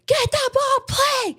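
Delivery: very high, strained coach's shout; imitated yelling. Coach yelling at players as ball goes out, imitated scream. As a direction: holler it like a furious youth coach. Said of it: "yelling at his own players".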